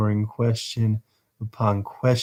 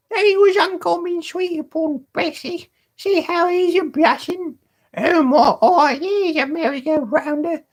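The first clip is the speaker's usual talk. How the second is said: high, mocking boy; high gleeful Charlie Bates. Charlie Bates teasing Tommy about Bessie, high glee. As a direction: crow it out, teasing and delighted.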